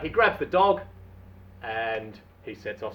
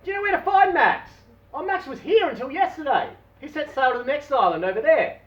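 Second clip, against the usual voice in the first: excited dialogue; 12 semitones higher, 5 dB louder than usual. Acted. Dave asks, lady answers.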